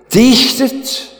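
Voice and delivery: high mocking sing-song; mock the false god. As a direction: prophet taunting Baal's priests, high and mocking. Prophet mocking the Baal god.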